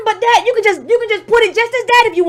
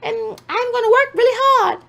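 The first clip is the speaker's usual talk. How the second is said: octave up voice; 5 semitones higher than usual. Retold or imitated. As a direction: push the voice up an octave to mock her. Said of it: "turn the octave up in your voice".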